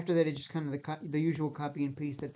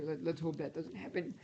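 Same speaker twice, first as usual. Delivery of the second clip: strained, hoarse voice; 7 dB quieter than usual. Real, not acted. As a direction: voice almost gone, rasping through. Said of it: "I'm almost losing my voice here".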